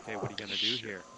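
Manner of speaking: usual speaking voice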